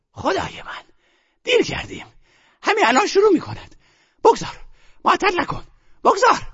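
agitated grandmother. A narrator's reading. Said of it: "مادر بزرگ به خود افتاده بود".

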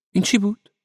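high-pitched, puzzled woman's voice; startled question. A narrator's reading. Parvaneh asks Ove about the banging, startled. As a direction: ask it high, taken by surprise.

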